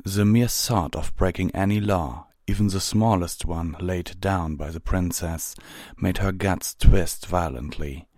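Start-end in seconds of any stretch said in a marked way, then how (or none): none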